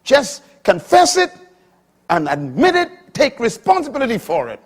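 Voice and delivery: high, loud exhortation; preaching. Acted. Preacher commanding the listeners to confess, forceful. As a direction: urge them hard.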